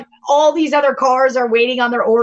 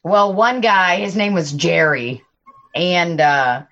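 usual speaking voice

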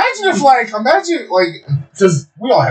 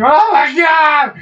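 high, slow, loud cry of pain; a hammed-up pained shout, much higher and louder; mock pained shout; 4 dB louder, much slower than usual. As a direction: yell it out like a stubbed toe. Retelling toe pain.

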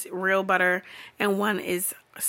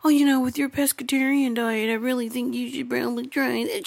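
higher, put-on lovey-dovey chatter; mocking couple voice; 4 semitones higher than usual. Partner advising pescatarian on soup, mocking imitation. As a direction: mimic an insufferably sweet partner.

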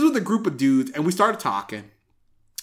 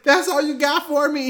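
much higher, mock outraged; mock indignant self; 11 semitones higher than usual. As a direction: squeak out the disbelief. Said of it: "And I was like".

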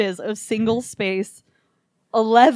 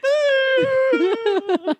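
mock shriek, much higher, laughing through it; mom's startled scream. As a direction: imitate a mom waking up terrified, half laughing. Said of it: "She'd be like".

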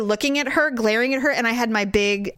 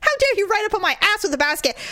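high, fast, hammed-up rant; mock furious tirade; 11 semitones higher, faster than usual. Wife's imagined rant at the shopper, high and hammed-up. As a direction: tear into her in a squeaky mock rage. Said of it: "It's like".

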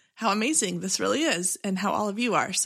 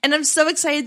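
excited; 6 semitones higher, 5 dB louder than usual. Real, not acted. Host celebrating two hundred episodes, thrilled. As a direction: marvel at the milestone out loud.

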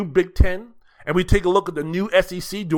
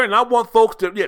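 usual speaking voice

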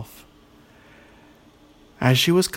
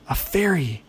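higher-pitched surprised exclamation; exclaiming; 5 semitones higher than usual. A narrator's reading. Ogre discovers a fairy in the bottle, exclaiming. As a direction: exclaim it high, then let it fall. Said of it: "exclaimed Ola".

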